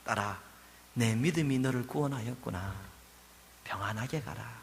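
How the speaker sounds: quiet reassuring farewell; gentle. Acted. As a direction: speak to her like a beloved daughter. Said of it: "이렇게 부드럽게 대해주시고".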